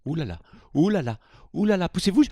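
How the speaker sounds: loud, frantic calling out to clear the way; mock rushing shout. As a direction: hurry off, yelling people aside. Rushing off again.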